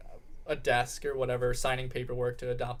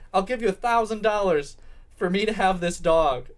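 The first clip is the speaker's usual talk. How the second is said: loud, higher-pitched mock bid; emphatic outbidding; 6 semitones higher, 7 dB louder than usual. Girl outbidding Mister Chang for the dog, emphatic. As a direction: declare the winning bid forcefully. Acted girlfriend outbids in haggle.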